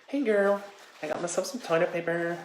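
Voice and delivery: put-on high, loud valley-girl gush; girly gushing voice. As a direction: gush like a trendy girl showing off.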